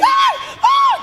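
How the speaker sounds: very high mock scream; short piercing imitated shrieks. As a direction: scream like an addict wanting attention. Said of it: "All the addicts outside screaming".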